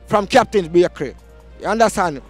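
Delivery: ordinary talk, nothing marked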